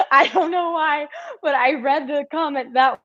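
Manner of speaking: high, breathless laughing through the words; laughing till crying. Real, not acted. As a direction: crack up while telling it. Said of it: "I was just crying, laughing".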